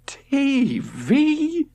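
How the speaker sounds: shocked high squeak; appalled. A narrator's reading. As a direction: recoil in disbelief. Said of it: "George was appalled".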